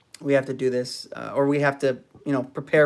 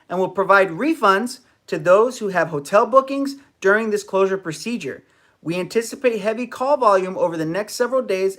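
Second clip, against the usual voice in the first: reading a statement; 5 semitones higher, 3 dB louder than usual. Shown as a demonstration. Reading Disney's closure statement aloud.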